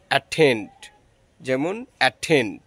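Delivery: অ্যাটেন্ড said with a puffed ঠ on the stressed syllable; aspirated stressed t. Shown as a demonstration. Teacher showing the stressed syllable, slow and puffed ঠ. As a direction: slowly lean on the ঠ in অ্যাটেন্ড. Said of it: "Bangla ঠ এর মতো হয়".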